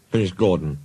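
usual speaking voice

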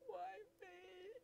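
high, tiny whimpering moan; high mock-despair whine. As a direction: whimper to the heavens.

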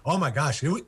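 normal talking voice

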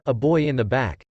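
ordinary delivery

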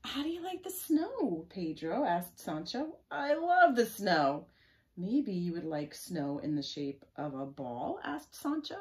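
animated reading with swinging pitch; story read-aloud. Acted. Reading Sancho coaxing Pedro about snow, animated. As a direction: act out the children's exchange as you read.

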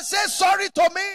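loud, high final order; stern shout. Retold or imitated. Father shouting his demand at his son, loud.

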